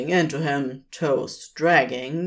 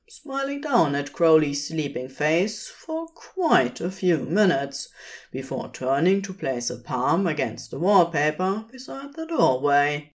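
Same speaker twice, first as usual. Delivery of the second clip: plain reading voice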